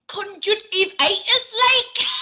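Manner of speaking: high, badly faked Geordie accent. Shown as a demonstration. Co-host asked for a try, hopeless Geordie accent. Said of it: "want you to do a Geordie accent".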